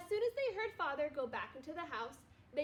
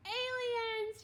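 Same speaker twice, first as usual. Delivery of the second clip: high shouted alarm; scream; 9 semitones higher, 3 dB louder than usual. A narrator's reading. D W hearing a tap at her window, screaming. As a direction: shriek it at the window. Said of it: "she screamed".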